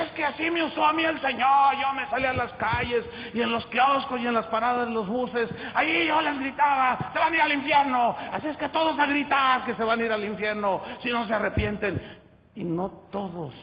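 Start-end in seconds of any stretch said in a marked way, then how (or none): 0.0-12.0 loud, high, fervent pastor voice; mimicking a zealous pastor. Mocking a pastor who shouted on the streets, loud and high. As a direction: play the street preacher bragging. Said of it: "y luego el pastor le dice".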